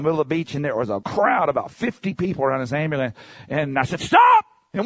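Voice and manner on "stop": sudden high shout of stop; jolting cry of stop. Retold or imitated. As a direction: yell it to stop the car. Yelling to stop the car.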